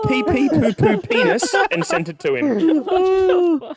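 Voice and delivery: very high, slow squeal through laughter; helpless laughter. Real, not acted. Teller repeating his scribbled words to friends, helpless with laughter. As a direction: drag the words out, cracking up. Repeats punchline while cracking up.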